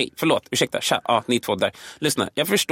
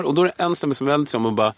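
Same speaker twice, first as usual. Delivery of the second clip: normal talking voice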